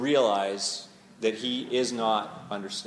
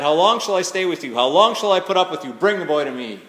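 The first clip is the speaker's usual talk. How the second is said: loud, raised-pitch exasperated rebuke; ticked off; 4 semitones higher, 9 dB louder than usual. Acted. Jesus to the unbelieving crowd, angry outburst. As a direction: act out an angry outburst at full voice. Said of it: "sounds really ticked off".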